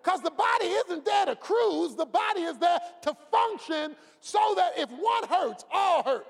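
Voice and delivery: loud, high, slow preacher's cry; preaching at full force. Acted. Pastor shouting to his church about the body. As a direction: preach it out to the congregation.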